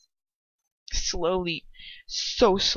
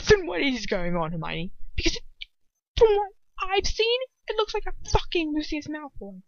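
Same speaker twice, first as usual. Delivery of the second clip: mounting fury, higher as it goes; furious; 7 semitones higher than usual. A narrator's reading. Ron confronting Hermione over Lucius Malfoy, mounting fury. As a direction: start contained, let the anger climb. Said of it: "blazing with fury".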